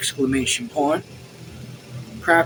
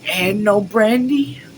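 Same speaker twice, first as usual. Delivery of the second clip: slow, raised, incredulous demand; furious; 6 semitones higher, 5 dB louder, slower than usual. A narrator's reading. Officer questioning servant about brandy, furious. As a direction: push the question out angrily. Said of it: "Tonder said furiously".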